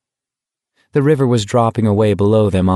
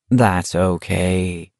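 calm him down gently; soothing; 2 semitones lower, much slower than usual. Acted. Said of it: "Stebbins said soothingly".

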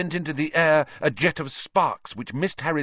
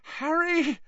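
much higher, frightened whimper. A narrator's reading. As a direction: plead through fear. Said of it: "Hermione whimpered".

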